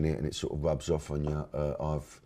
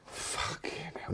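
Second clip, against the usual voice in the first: whispered curse; 8 dB quieter, slower than usual. Real, not acted. Exasperated at the quiz.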